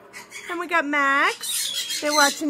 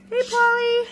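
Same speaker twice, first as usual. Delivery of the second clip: much higher hello to the bird; high pet greeting; 7 semitones higher than usual. Real, not acted. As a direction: greet Pauly sweetly.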